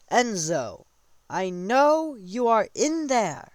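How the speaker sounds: loud, high, drawn-out call. Retold or imitated. King calls Enzo out of hiding, loud slow summons. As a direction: call them out slowly, stretching the words.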